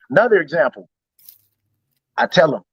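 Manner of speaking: ordinary talk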